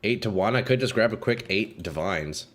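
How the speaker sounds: ordinary talk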